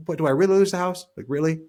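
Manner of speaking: high and loud, fast disbelief; sudden high-pitched doubt, as if catching yourself overreacting; incredulous self-questioning. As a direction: scoff at your own panic: really?. Challenging his own worst-case thought.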